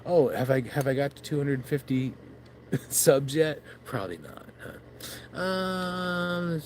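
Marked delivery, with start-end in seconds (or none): none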